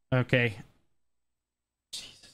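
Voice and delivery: normal talking voice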